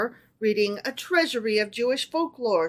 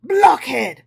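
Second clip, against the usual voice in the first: sharp, louder impatient outburst; impatient exclamation; 4 semitones higher, 7 dB louder than usual. A narrator's reading. Emperor scolding tailor, impatient louder outburst. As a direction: Napoleon snapping at the tailor's small wish. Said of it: "exclaimed Napoleon impatiently".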